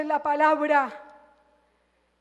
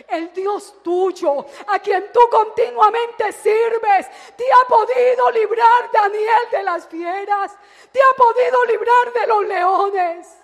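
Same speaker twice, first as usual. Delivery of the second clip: anguished cry; 6 semitones higher, 10 dB louder than usual. Retold or imitated. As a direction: cry out desperate for an answer. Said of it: "con voz muy triste". (súplica angustiada a gritos)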